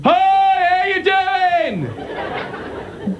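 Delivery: hammed-up high Australian hello; mock Australian greeting. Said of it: "Ever meet Australians? Always like".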